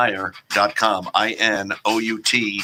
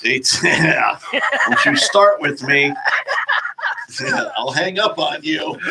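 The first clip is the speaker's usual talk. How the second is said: laughing; 7 semitones higher, slower than usual. Real, not acted. Host laughing, teasing co-host.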